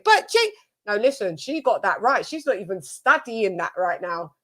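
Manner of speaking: usual speaking voice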